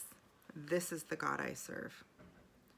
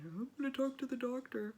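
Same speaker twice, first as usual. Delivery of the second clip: high-pitched retold crying; imitated crying voice; 8 semitones higher than usual. Wife telling her man why she was crying, imitated high tearful voice. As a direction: imitate telling someone through tears, pitched up. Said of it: "I told him why I was crying".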